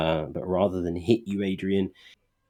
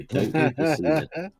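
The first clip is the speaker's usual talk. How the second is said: mock warning; 10 semitones higher, 4 dB louder than usual. GM joking warning.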